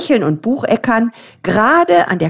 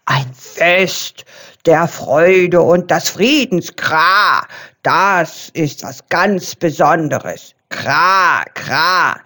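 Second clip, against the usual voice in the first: lower, croaky raven voice with caws; joyful raven, voiced lower; croaking raven; 4 semitones lower, slower than usual. A narrator's reading. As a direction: croak it out, glad and raspy like a raven. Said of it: "krächzte er freudig".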